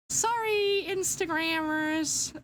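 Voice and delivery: playful apology. Real, not acted.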